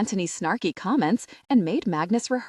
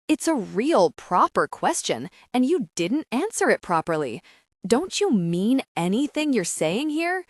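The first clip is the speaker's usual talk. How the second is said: raised voice, heated and emphatic; angry explosion; 4 semitones higher, 3 dB louder than usual. A narrator's reading. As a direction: challenge him in open indignation. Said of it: "I explode".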